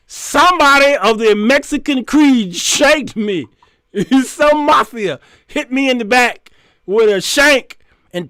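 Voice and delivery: high, loud hammed-up declaration; thespian proclamation. Defendant warns the audience he isn't suicidal, mock-theatrical. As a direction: proclaim it dramatically to the whole room. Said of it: "he went on with his thespian".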